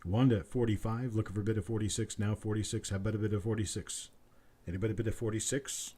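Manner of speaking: quick steady auctioneer patter; auction bid calling. Acted. Auctioneer calls for the next bid in brisk patter. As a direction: keep the bids rolling like an auctioneer.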